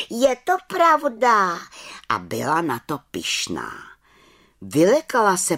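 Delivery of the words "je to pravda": "je to pravda" called high, loud and slow; calling out. A narrator's reading. Little witch confirming to the head witch, calling "je to pravda". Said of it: "volala malá čarodějnice".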